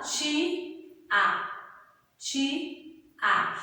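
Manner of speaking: Ti and a said as two separate syllables; syllables split apart. Shown as a demonstration. Teacher to pupils, sounding out Ti and a apart. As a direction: pull Ti and a clearly apart. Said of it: "Eu ouço claramente ti".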